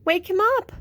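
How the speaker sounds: high, loud squirrel; bossy little squirrel. A narrator's reading. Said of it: "said the squirrel".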